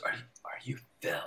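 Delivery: barely voiced, halting query; imitating a timid whispering stranger; hushed hesitant question. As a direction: repeat it under your breath, unsure. Stranger repeats her tentative question.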